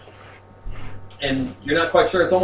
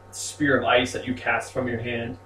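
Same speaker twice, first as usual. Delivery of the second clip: normal talking voice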